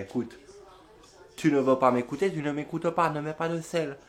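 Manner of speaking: usual speaking voice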